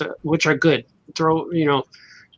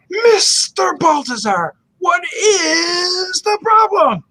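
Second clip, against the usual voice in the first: high, slow retold teacher shout; teacher yelling; 14 semitones higher, much slower than usual. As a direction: bark it like a strict teacher catching a whisperer. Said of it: "getting yelled at".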